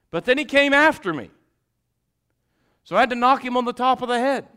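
loud, high, driving sermon voice; full-force preaching. Acted. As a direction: hammer each line at the congregation.

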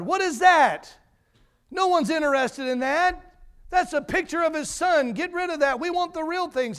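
high, loud indignant shout; shouted from the crowd. Retold or imitated. Bidder in the crowd yells at auctioneer, high indignant shout. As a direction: protest loudly from the crowd. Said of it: "someone shouted from the crowd".

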